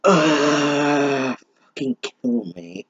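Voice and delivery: usual speaking voice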